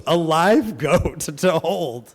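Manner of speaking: slow, laughing through the words; can't help laughing at the goat; teacher laughing while telling of the goat. Real, not acted. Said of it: "I had to laugh".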